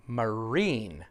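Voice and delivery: "Marine" with a long, stressed "rine"; slow it down and lean on the end of "Marine"; stress on second syllable. Shown as a demonstration. Teacher showing what the student meant, stress on second syllable. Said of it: "second syllable".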